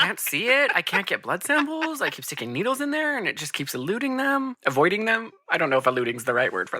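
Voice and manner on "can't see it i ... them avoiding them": high, whiny doctor voice; mock Carlisle. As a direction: play the doctor flustered. Voicing Carlisle's helplessness.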